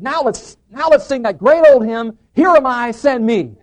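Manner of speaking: big, high, loud podium voice; loud, drawn-out call to sing; hymn announcement. Acted. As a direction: announce the hymn out to the whole congregation. Brother-in-law announcing hymn from podium.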